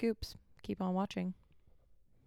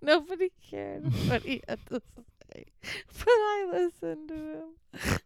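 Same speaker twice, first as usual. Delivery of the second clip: mock crying; 10 semitones higher, 5 dB louder than usual. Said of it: "you can always say in the future".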